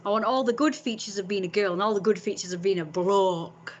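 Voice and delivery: ordinary talk, nothing marked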